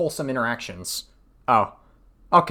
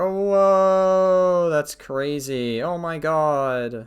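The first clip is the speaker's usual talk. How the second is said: mock amazement; 4 semitones higher, much slower than usual. Real, not acted. Mock shock at the DMs.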